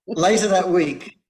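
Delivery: normal talking voice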